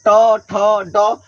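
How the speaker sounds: ट, ठ, ड spoken very slowly, each one held; drawn-out sound demo. Shown as a demonstration. Teacher demonstrating ट, ठ, ड to students, very slow. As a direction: pull each sound out so the tongue position shows. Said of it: "जैसे देख लीजिए".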